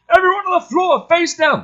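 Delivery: high, loud barked order; a loud, high military shout; soldier's yell. Acted. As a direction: yell it at the prisoners. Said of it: "yells to the prisoners".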